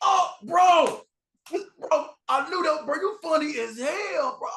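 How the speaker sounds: high, loud gushing praise; gushing fan. Retold or imitated. Fan gushing at the creator, high and excited. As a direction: gush like you can't contain it.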